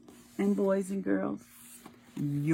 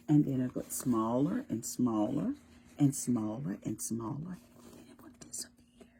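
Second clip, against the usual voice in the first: fading to a whisper; 5 semitones lower, 5 dB quieter than usual. Shown as a demonstration. Moon shrinking, voice shrinks.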